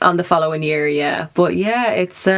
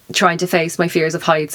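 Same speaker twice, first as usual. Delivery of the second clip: ordinary talk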